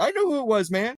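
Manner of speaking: high-pitched retort; mock indignation. Answering the guy who explained Alec Guinness, mock indignation. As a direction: protest that this is obvious, voice up high.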